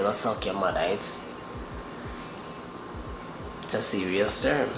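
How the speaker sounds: ordinary talk, nothing marked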